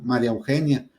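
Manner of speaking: "María Eugenia" as Mariaugenia, no í stress; run together as one word. Shown as a demonstration. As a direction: glue "María" onto "Eugenia", losing the í. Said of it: "Como si pegáramos en una sola palabra".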